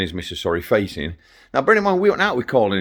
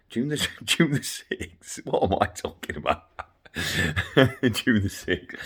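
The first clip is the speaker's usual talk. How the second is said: laughing at himself; 6 dB quieter, slower than usual. Real, not acted. Laughs at own date mistake.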